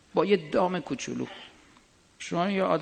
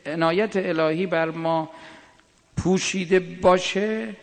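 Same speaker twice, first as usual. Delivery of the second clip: ordinary delivery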